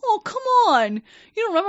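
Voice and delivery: high, loud, whining mock plea; a hammed-up pleading creep; whiny coworker. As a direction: mimic the coworker's wounded whine, way up high.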